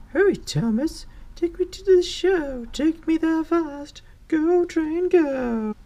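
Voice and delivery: high-pitched, urgent squeaky judge character; high little voice, urging the train on; hurrying judge. Acted. Judge hurrying Thomas to the train show, high hurried voice.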